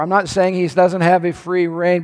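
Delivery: ordinary talk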